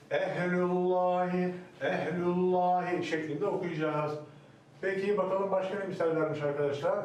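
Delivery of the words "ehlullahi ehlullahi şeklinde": "ehlullahi" drawn out slowly, thin lü then thick lam; slow demo. Shown as a demonstration. Teacher modelling "ehlullahi" slowly for the class. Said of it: "Yani şöyle".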